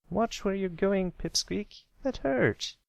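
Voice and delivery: put-on high, irritated young filly; annoyed filly voice. Scootaloo scolding Pipsqueak, high and annoyed. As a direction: scold the colt who bumped into you.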